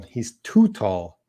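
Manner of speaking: too drawn out and stressed, slow falling tune. Shown as a demonstration. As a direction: say he's too tall slowly, leaning on too. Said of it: "But this one".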